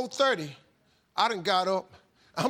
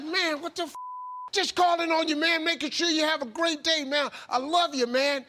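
much higher, louder, bursting with energy; over-the-top cheerful; 9 semitones higher, 5 dB louder than usual. Retold or imitated. John waking Steve with a call, booming and cheerful. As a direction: boom it out like a hype man at dawn. Impersonating energetic morning caller.

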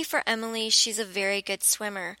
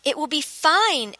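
slow, firm "It will be fine" with the weight on "fine"; Emily insisting calmly as she lets go; 6 semitones higher, 4 dB louder than usual. A narrator's reading. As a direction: put it down firmly, no argument. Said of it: "Emily repeated firmly".

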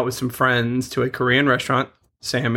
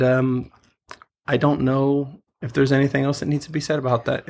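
ordinary talk

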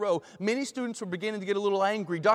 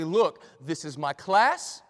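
stern; 3 dB louder than usual. Acted. Professor to a protesting student about his class, stern. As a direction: lay down the law, each phrase set apart. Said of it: "Doctor Christensen said sternly".

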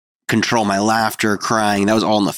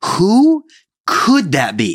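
mock alarm; 10 semitones higher, 3 dB louder, much slower than usual. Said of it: "my reaction was like".

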